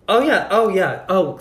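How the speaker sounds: high excited gushing. Retold or imitated. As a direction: gush like an excited tourist. Retelling excited tourists.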